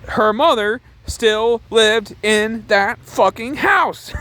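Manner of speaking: high, slow, emphatic shout; outraged. Real, not acted. Reviewer appalled she burned her mother's house, outraged. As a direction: hammer out each word in disbelief.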